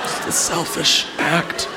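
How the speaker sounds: soft, slow, breathy whisper; hushed repetition. Acted. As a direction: whisper it slowly in mock reproach. Repeats punchline in hushed reproach.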